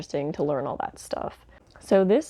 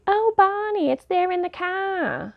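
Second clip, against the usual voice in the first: mock New Hampshire accent; 13 semitones higher than usual. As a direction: parody the actress's overdone New Hampshire accent. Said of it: "she's trying to do this, like, New Hampshire accent".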